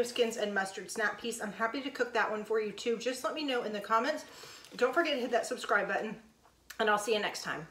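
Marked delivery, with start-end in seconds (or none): none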